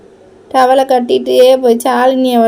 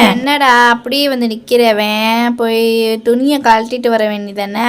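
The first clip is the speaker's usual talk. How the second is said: ordinary talk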